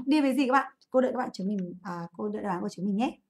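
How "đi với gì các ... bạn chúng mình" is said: normal talking voice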